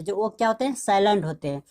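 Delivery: ordinary delivery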